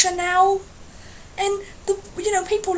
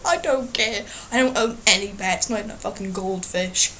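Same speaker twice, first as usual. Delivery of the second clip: ordinary talk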